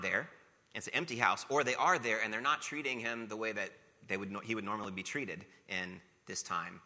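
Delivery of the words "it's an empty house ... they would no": ordinary talk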